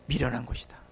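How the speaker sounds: 속삭이듯 성경 구절 끝맺기; a hushed whisper to end the verse. Acted. Reading scripture, drops voice. (숨소리로 속삭이며)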